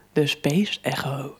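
soft unvoiced whisper. Real, not acted. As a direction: breathe the name quietly. Names the effect softly.

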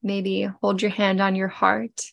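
normal talking voice

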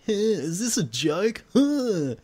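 mock an outraged whiny nerd. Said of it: "pissy little fanboys and were like".

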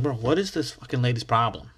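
ordinary delivery

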